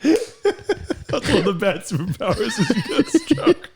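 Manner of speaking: high, slow, helpless laughing; laughing through words. Real, not acted. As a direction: crack up while getting the line out. Cracking up at sketch's absurd joke.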